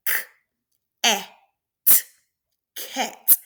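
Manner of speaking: K, a, t sounded one by one, then blended into cat; sounding out words. Shown as a demonstration. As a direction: segment cat sound by sound, pause between each. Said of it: "I would look at that word and say".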